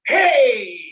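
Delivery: loud cheering hey; rousing hey; shout hey. Shown as a demonstration. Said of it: "You have to say".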